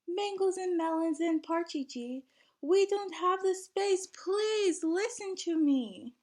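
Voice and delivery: high, pleading protest, slowing at the end; Musa protesting. Acted. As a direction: beg them to listen. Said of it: "Musa cried out and began to protest".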